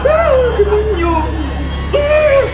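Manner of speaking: yelling in fright at a demon; hammed-up terrified yells; frightened cries. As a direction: scream at the monster. Scared by the face mask.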